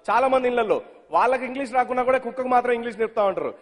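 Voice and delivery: ordinary talk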